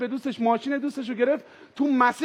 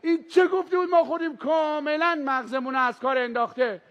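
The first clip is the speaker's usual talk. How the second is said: shouted higher and louder, fading at the end; louder mock yell; 4 semitones higher than usual. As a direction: yell it wherever he is, then drop off. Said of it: "گفتم".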